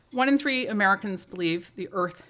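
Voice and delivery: usual speaking voice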